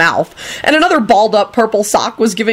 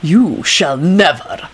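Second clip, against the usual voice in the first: slow, measured cold menace; icy, deliberate threat; 2 semitones lower, much slower than usual. A narrator's reading. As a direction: weigh each word icily. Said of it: "he said icily".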